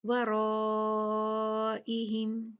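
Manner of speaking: वराइहिम with a long, level held aa; long madd. Shown as a demonstration. Teacher demonstrating the stretch, drawn-out aa in वराइहिम. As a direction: hold the raa of वराइहिम for several beats, then finish short. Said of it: "चार से पांच count भी खींच सकते हैं".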